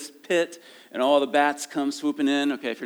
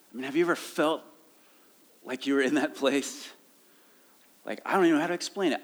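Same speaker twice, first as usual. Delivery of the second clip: ordinary talk, nothing marked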